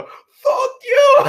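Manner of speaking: high, loud hammed-up yell; out-loud heckle. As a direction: holler it at a cinema screen. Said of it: "you'll hear me out loud".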